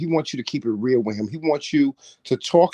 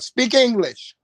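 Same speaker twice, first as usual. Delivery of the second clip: mock Lord's command; 8 semitones higher, slower than usual. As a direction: snap it as an irritated Lord. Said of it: "The Lord be like".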